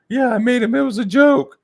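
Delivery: loud falsetto mocking impression; squeaky-high caricature; Nicholas. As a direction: protest in a silly high voice. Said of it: "he sounds like that".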